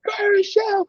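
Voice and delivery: high shrieked cheer at the screen; retold scream. Little brother cheering the Aussie at the laptop, high scream. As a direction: yell it like a kid at a finish. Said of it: "he's screaming at the laptop".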